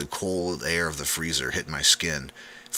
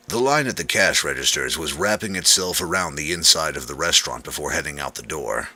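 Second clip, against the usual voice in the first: ordinary delivery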